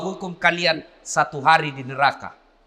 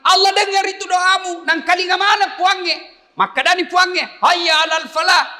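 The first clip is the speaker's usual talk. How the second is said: high, loud pulpit voice; fiery preaching; 13 semitones higher, 5 dB louder than usual. Acted. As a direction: preach it with full force. Preaching at full force.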